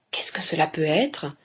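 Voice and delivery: hushed, near-whispered inner thought; pensée secrète. A narrator's reading. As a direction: murmur it as a private thought. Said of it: "pensait-il". (pensée murmurée tout bas)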